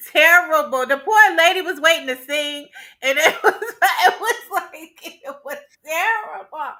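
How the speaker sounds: laughing too hard to finish the thought; real laughter. Real, not acted. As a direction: talk through helpless giggles. Cracking up over the awful date.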